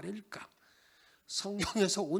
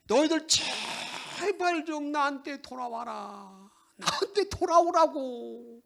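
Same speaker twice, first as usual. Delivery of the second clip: slow, higher voice of God pleading; 6 semitones higher, 5 dB louder, slower than usual. Acted. God pleading with his people, slow and high. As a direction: beg them slowly to come back. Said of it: "하나님께서 백성들에게 요구합니다".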